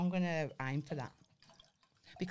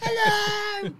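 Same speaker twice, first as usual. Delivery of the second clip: mock greeting; 9 dB louder than usual. Dad barging into wedding podcast.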